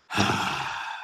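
long, soft weary sigh; heavy sigh. Retold or imitated. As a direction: sigh like a tired dad picking up the phone. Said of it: "he would do this".

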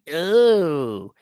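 slow, drawn-out disgusted caricature; mock New York lefty women. Lefty women reacting to a plumber husband, mock disgust. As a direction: put on a long, snobbish "ooh" of distaste. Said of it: "All the New York lefty women".

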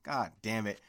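ordinary talk

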